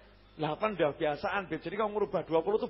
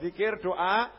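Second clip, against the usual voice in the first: normal talking voice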